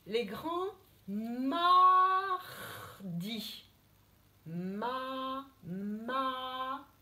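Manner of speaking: "Ma, ma" drawn out slowly so the sound is heard; sounding out syllables. Shown as a demonstration. Teacher to the older pupils, slowly sounding out the first syllable. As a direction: stretch "mardis" and split off "ma". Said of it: "On entend A".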